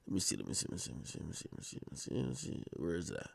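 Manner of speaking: very soft, fading, half-whispered; muttering to himself. Real, not acted. Host searching for the clip, muttering. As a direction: mumble while searching. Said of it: "host searching, muttering".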